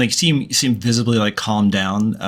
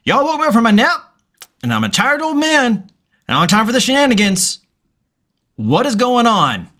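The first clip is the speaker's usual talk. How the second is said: put-on much higher, grumpy old farmer woken from a nap; tired old man; 11 semitones higher, faster than usual. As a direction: whine it like a cranky old man dragged out of bed. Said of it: "he's gonna be like".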